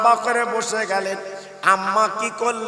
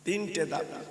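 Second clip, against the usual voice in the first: very soft, low echo of the phrase; hushed aside; 6 semitones lower, 15 dB quieter than usual. A narrator's reading. Preacher letting the wife's three demands sink in, hushed. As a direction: drop to a hush for weight.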